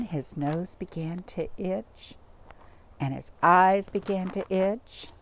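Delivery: plain reading voice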